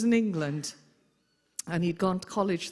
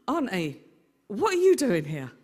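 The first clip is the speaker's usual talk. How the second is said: shocked; 2 semitones higher, 6 dB louder than usual. Retold or imitated. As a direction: caught out, louder on what are you doing here. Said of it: "he looked absolutely shocked".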